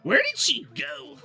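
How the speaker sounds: character voice. Acted. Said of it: "my voices kept blending together".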